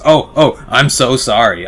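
clumsy cab driver apologizing for the mess; flustered apology. Acted. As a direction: blurt it out after spilling the cups. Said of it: "and goes".

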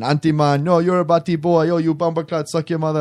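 hammed-up mock Jamaican, pitched slightly up; bad Jamaican accent. Friends joking at rehearsal dinner, bad Jamaican accent. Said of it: "all this bad Jamaican accent".

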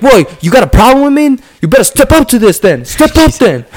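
high, loud challenging clown; Joker taunt. Retold or imitated. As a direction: bait him to fight.

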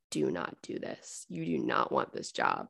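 low hushed inner warning, barely voiced. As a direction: murmur it like a gut feeling warning you off. Her body's inner warning voice.

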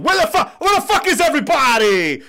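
high, loud exasperated shout; impatient yell. Retold or imitated. Go-getter shouting for no-shows, loud and fed up. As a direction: holler it across the lot like you've been waiting forever. Go-getters yelling for missing allies.

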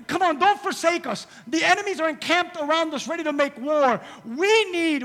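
loud, high preaching. Acted. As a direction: preach with fire. Preaching at full force.

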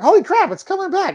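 high, excited exclamation; retold amazement. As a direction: blurt it out in delighted disbelief. Retells amazed language return.

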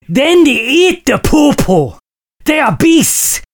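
ordinary delivery

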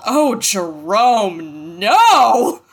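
very slow, stretched, building to a loud NO; hammed-up, drawn-out dismay; comic catchphrase wail. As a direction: draw out each word like a scolding catchphrase. Performs recurring comedy segment title.

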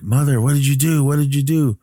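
high-pitched imitation of a distraught son; retold panicked cry to mother; mock frantic snapping. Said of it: "He starts snapping".

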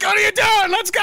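high, loud urgent yell; hammed-up soldiers shouting to get going; mock yelling comrades. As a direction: shout at him to move. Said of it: "Everybody's like".

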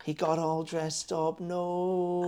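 soft, slow, drawn-out cooing; imitated smitten girlfriend. Comedian as girlfriend adoring dressed-up dog, soft slow coo. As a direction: mimic melting over a cute dog, ending high. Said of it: "and she was like".

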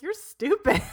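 high, loud mock-scornful retort; hammed-up incredulous insult; exasperated put-down. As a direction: dismiss it with playful exasperation. Acts her dismissing his age objection.

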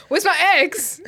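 mock outraged question. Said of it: "and he's like".

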